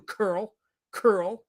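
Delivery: Carol squashed into one syllable, Curl; butchered name. Pretend. Showing Carol he can butcher names too, taunting Curl. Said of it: "butcher names too".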